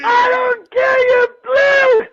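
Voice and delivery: frantic shouting. Real, not acted. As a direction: scream at it to go away. [name] shouting the flu away.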